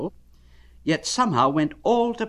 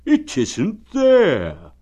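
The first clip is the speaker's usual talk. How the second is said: higher, louder, slow friendly bear voice; cheerful bear; 9 semitones higher, 8 dB louder, much slower than usual. Acted. Pooh asking Eeyore how he is, friendly. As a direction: ask it kindly like a plump bear.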